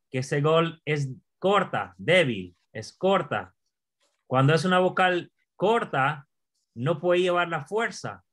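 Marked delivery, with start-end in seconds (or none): none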